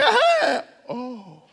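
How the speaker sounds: high laugh breaking through tears; tearful happy laugh. Retold or imitated. Daughters crying then laughing with hope. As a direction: laugh out relief after crying.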